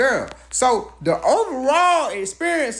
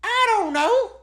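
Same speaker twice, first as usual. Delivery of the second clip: mock clueless; 13 semitones higher, 4 dB louder than usual. Mock innocent shrug.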